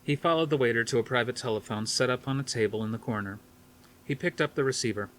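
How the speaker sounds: ordinary delivery, nothing marked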